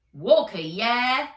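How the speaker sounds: a streetwise robot raccoon leaning out to check the name; loud slow cabbie voice; raccoon cab driver. A narrator's reading.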